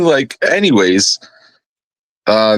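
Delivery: usual speaking voice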